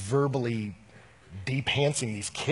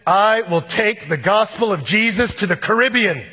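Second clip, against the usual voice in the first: loud, high heroic declaration; ringing, raised-pitch vow; bold proclamation; 9 semitones higher, 12 dB louder than usual. Acted. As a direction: announce a grand mission at full voice. Acted bold missionary declaration.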